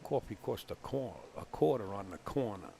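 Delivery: broad Bronx coffee-and-quarter line; Bronx accent. Shown as a demonstration. Commentator reading out a tongue-twister request, Bronx accent. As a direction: round out the vowels, Bronx style. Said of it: "That's a certified Bronx accent".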